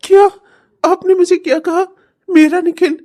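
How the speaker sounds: high, loud astonished question; can't believe his ears; stunned disbelief. Acted. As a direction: son startled as mother recognises him. Son hears mother name him.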